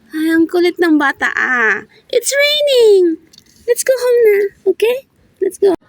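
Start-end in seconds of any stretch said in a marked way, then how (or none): 2.1-5.8 whiny pleading. Real, not acted. Pleading, half-crying.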